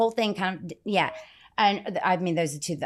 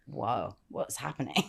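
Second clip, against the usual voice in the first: mock-startled security staff; 5 semitones lower, 7 dB quieter, slower than usual. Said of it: "They're like".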